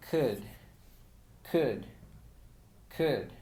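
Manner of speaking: "could" said as k plus a short vowel plus d, no L; silent-L could. Shown as a demonstration. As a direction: drop the L in "could". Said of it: "esta palabra sonaría como".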